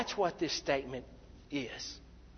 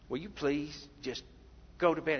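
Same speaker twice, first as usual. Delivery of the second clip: quieter, restrained plea; acted tired priest wanting sleep; weary exasperation; 3 semitones lower, 5 dB quieter than usual. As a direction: beg him wearily to leave. Weary priest, third interruption.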